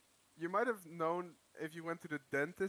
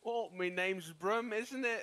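slow, louder mock Birmingham accent; Brummy accent; 4 semitones higher, 5 dB louder, slower than usual. Playing a Brummy to amuse friends, hammed-up accent. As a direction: ham up a Brummy accent, drawn out. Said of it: "I think it sounded closest to Brummy".

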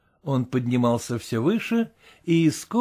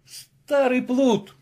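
slow, higher relieved cry; relieved shout; 9 semitones higher, much slower than usual. A narrator's reading. Master shouts at servant, relieved. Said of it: "закричал Легран с видимым облегчением".

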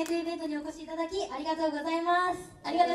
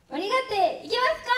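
fast, higher call to the crowd; rally the fans loudly; asking the crowd if they're hyped, calling out; crowd hype call; 6 semitones higher, 4 dB louder than usual. Real, not acted. Idol hyping the crowd.

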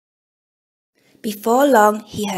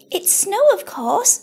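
much higher, squeaky little mouse voice; 11 semitones higher than usual. Acted. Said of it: "squeaked Mouse".

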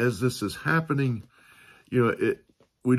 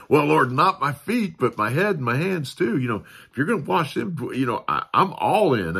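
louder, higher, eager rush; enthusiastic Peter; 6 semitones higher, 5 dB louder than usual. Retold or imitated. Peter eagerly offering head and hands to Jesus, excited. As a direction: jump in with both feet. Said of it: "ever the enthusiastic one".

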